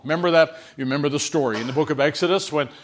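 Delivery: normal talking voice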